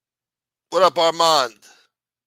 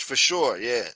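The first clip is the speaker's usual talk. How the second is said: normal talking voice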